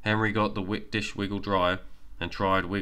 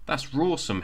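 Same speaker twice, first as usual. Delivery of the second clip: shouting; 7 semitones higher than usual. Acted. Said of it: "Henry shouted".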